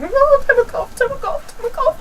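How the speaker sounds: high-pitched flustered repetition; acted panic. Pupil rushing to obey shouting lady, mock-panicked high babble. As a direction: repeat it in a hammed-up squeaky fluster. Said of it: "So I was like".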